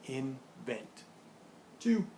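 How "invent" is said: "Invent" split into in, vent with a pause; separated syllables in "Invent". Shown as a demonstration. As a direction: break "Invent" in two, softer. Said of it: "How many syllables does invent have?".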